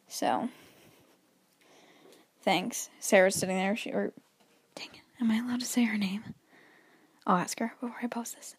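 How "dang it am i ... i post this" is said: whispered aside. Real, not acted. Wonders aloud if name allowed.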